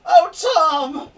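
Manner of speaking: high, loud crying call to her husband. Acted. Wife bursting in crying to her husband. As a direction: burst in wailing his name. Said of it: "she bursts into the room".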